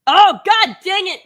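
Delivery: high, loud burst of frustration; exasperated. Real, not acted. Corrected on an old-fashioned word, loud exasperation. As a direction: exclaim it in exasperation.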